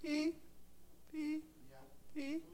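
high soft beeps like a smoke alarm; quiet high beep beep beep. Retold or imitated. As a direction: imitate a chirping alarm. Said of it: "conscience is like this".

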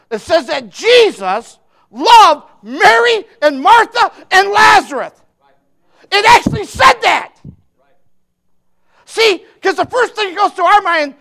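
loud, high-pitched preacher's shout; full-force preaching. Real, not acted.

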